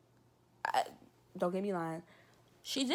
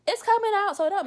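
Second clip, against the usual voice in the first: high, loud exasperated cry; loud high exasperation; exasperated exclamation; 12 semitones higher, 11 dB louder, faster than usual. Real, not acted. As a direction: throw up your hands at it out loud.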